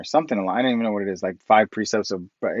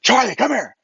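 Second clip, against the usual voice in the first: higher, louder call across the store; excited call; 12 semitones higher, 5 dB louder than usual. Retold or imitated. Calling his buddy Charlie over to the computer, excited.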